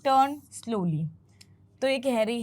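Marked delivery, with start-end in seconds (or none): 0.1-1.1 drawn-out, slow turn slowly; slow and deliberate; slow demo. Shown as a demonstration. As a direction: stretch each word out slowly. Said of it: "यानी कि धीरे से".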